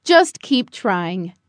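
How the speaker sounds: low, slow fatherly voice; encouraging father. A narrator's reading. Father to Buddy practicing flying, calmly encouraging. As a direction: encourage steadily, low and drawn out. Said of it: "Father said".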